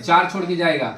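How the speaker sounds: usual speaking voice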